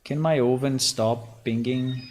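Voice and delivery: ordinary talk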